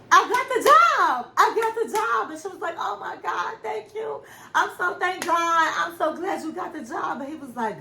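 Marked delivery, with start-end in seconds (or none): 0.1-2.3 high, loud, thrilled shout of good news; retold husband yelling his big win; excited announcement. Husband announcing he got job. 2.8-7.2 excited relief. Retold or imitated. Wife reacting to job news.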